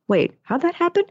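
high-pitched, wondering question; puzzled surprise. Retold or imitated. Someone suddenly laughing for no reason, puzzled and surprised. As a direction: act out pleasant bewilderment, voice jumping up.

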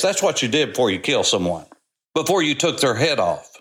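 ordinary talk, nothing marked

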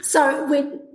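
ordinary delivery

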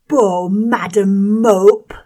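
drawn-out whining schoolboy taunt; whining bully. Acted. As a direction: stretch it out, whining and nasal. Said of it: "cried the whining and nasal voice".

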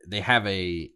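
usual speaking voice

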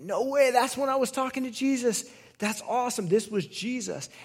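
father realizing it was Jesus, amazed; amazed realization. Retold or imitated. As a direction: let the surprise lift the voice high. Official realizes the timing.

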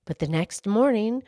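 gentle read-aloud storyteller voice; story narration. A narrator's reading. Host reading a children's story aloud, storytelling. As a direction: read it like a bedtime story to kids.

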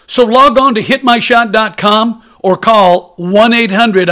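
pushy TV pitchman; infomercial. Acted. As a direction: sell it like a late-night ad.

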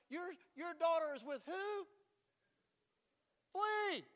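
high, incredulous question, then an urgent order; alarmed pastor. Acted. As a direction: act out shocked pastoral advice. Acts pastor alarmed at parent.

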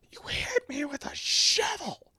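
slow, breathy whisper of the line; whispered quote. Retold or imitated. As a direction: hush it like a wounded aside. Quoting favorite movie line.

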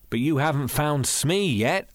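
mildly aggrieved, drawn out; complaining. A narrator's reading. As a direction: complain with a rising end. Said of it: "I complained".

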